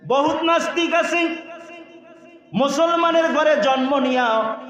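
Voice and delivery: sudden high-pitched denunciation; impassioned preaching. Real, not acted. (উঁচু গলায় জোরালো ওয়াজ)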